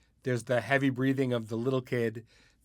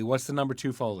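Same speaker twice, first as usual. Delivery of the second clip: usual speaking voice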